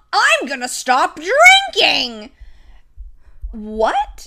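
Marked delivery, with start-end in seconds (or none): 0.1-2.3 loud, high and eager; eager declaration. Retold or imitated. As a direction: blurt it out proudly. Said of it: "turns to her and is like".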